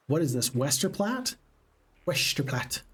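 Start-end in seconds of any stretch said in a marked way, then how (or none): 2.1-2.8 slurred Scottish accent, s turned to sh; Scottish impression with a shushing s; Sean Connery. Shown as a demonstration. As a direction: say "Westerplatte" as "Weshterplatte" in a Scottish accent. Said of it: "with this sort of accent".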